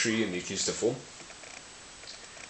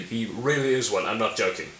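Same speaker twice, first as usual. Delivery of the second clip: usual speaking voice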